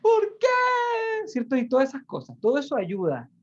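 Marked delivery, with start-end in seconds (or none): 0.0-1.3 high, whining cry of "¿Por qué?"; mock wailing cry. The tearful person who lets tears run, mock wailing high. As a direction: wail it like a crying person, hammed-up. Acting out a crying wail.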